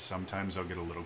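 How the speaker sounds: low, restrained delivery. Shown as a demonstration. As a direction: pull the voice down low and quick. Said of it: "the volume of my speech".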